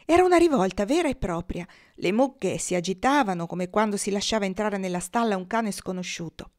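plain reading voice

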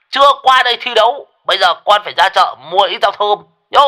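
high, loud ranting; old Đồng raging over the phone about the goat; furious old man. A narrator's reading. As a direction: rant at him, fast and furious. Đồng rants angrily on phone.

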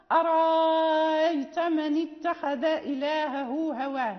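"فأرأيت" with a long alif held, slow; Warsh substitution. Shown as a demonstration. Teacher demonstrates Warsh's second way, long held madd. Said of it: "الوجه الثاني لورش".

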